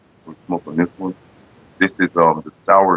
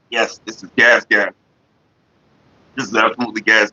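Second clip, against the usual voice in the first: ordinary talk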